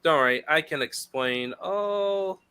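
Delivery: ordinary talk, nothing marked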